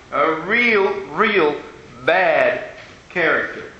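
slow, weighty emphasis on real real; emphatic real real. Shown as a demonstration. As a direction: lean on each real, then slow down on bad character. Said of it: "repeated real for emphasis".

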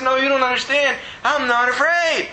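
high, insistent excited cry; retold happy bawling. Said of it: "I'm still bawling".

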